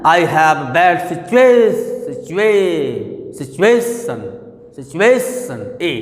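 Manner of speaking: slow, "situation" with a long, strong A; stress on A. Shown as a demonstration. Teacher showing where pressure falls, "situation" stressed on A. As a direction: keep leaning on the A in "situation", repeating it. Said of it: "ए पर दबाव आ रहा".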